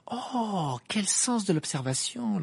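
high, drawn-out sarcasm; acid. A narrator's reading. Hermione answering Ron who noticed she's a girl, acid. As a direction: make the praise sting. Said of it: "lança Hermione d'un ton acide".

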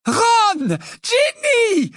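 high eager calling out. A narrator's reading. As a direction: call the kids across the platform. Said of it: "appela Missus Westley".

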